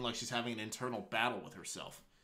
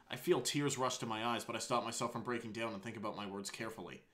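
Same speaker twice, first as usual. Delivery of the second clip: ordinary delivery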